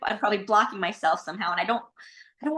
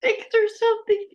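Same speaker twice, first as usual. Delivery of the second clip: crying; 8 semitones higher than usual. Real, not acted. Client answers the coach while crying, feeling six years old. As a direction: let the tears come through the words. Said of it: "The one who's crying".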